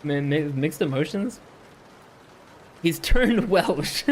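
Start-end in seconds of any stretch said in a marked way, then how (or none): 2.8-4.1 laughing. Real, not acted. Laughs at reader's accent.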